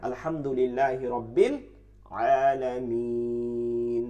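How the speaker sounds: slow recitation with a long drawn-out Alamin; Quranic recitation. Shown as a demonstration. Teacher reciting an example verse to students, slow chant. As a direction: chant it and hold the final syllable.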